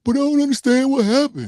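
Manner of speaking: high, puzzled whine of someone clueless; mock confused voice. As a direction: play the clueless fool asking what happened. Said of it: "and still look around like".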